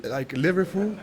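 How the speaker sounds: Liverpool said in a light mock Scouse accent; a laughing stab at the Scouse accent for Liverpool. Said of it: "Liverpool is like Liverpool".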